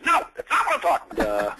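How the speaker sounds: a sharp high impression of the boss; Steve Schiffman impression. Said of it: "that's a pretty good impression".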